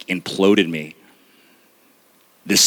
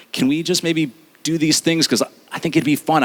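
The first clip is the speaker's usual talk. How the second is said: much higher, tentative asking voice; timid request; 8 semitones higher than usual. Retold or imitated. Husband timidly proposing plans to his wife, much higher and hesitant. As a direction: ask gingerly, afraid to upset.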